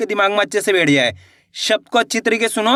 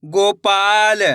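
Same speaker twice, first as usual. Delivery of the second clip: दरोगा drawn out very slowly; teacher blending syllables slowly for kids; slowly blended word; much slower than usual. Shown as a demonstration. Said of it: "गो पा ल".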